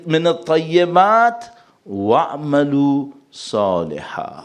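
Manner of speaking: slow, drawn-out chanted Arabic recitation; Quranic recitation. Acted. Preacher to congregation, slow chanted recitation. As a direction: intone the verse slowly, like a qari.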